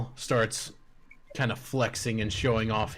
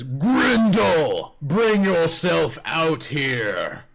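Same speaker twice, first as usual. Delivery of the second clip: calling out, high and loud; yelling; 6 semitones higher, 6 dB louder, much slower than usual. Acted. Goblin chief summons his ogre champion, yelling loud. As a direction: bellow across the camp, slow. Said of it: "And he yells out".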